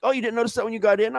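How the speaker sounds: high, quick mock-casual Mike; imitating Mike. Voicing Mike shrugging off the cage, high and fast. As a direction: toss it off fast and breezy. Quoting Mike's casual reply.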